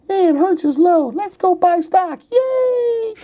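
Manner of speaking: high, giddy mock voice of a clueless new investor; naive investor voice. Host mocking new investors in a giddy high voice. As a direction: play the gullible buyer, squeaky and thrilled.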